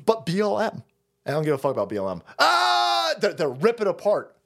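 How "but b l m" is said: mock whining protester. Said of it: "they're just gonna scream".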